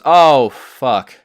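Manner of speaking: a manager spotting the muddy team walk in, loud dismay; mock dismayed curse. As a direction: blurt it like the manager sees trouble coming. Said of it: "She looks at us and goes".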